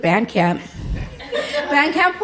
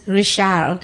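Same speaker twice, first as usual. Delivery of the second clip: French Richard; 4 semitones lower than usual. Retold or imitated. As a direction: say "Richard" like a French speaker, Ree-shar. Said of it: "Goes to France".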